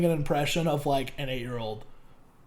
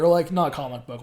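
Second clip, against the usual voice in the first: usual speaking voice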